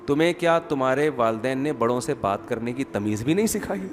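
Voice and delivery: lightly acted, measured taunt; taunting mother-in-law. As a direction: quietly needle as an offended mother-in-law. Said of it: "ساس پھر جواب میں کہے گی".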